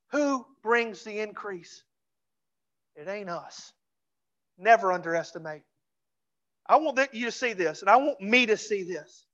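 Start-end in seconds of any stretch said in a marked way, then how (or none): none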